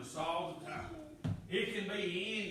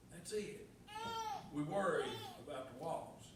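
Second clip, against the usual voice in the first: choked, much softer, on the edge of tears; voice breaking; 2 semitones lower, 7 dB quieter than usual. Real, not acted. Preacher moved to tears before congregation, voice cracking. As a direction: let it catch in the throat and fade.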